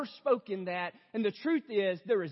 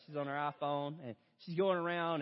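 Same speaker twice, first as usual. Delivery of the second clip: ordinary talk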